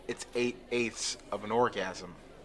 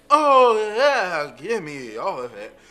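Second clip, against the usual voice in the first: climax moan; 5 semitones higher, 10 dB louder, slower than usual. Acted. Pleasure after sneeze.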